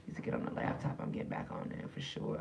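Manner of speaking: whispered aside off mic. Real, not acted. Whispered aside to family while live. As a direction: whisper to someone in the room.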